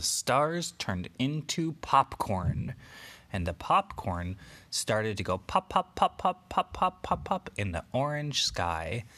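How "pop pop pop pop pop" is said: high, louder playful pops; popping sound. Acted. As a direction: pop each word like popcorn. Popcorn popping sound effect.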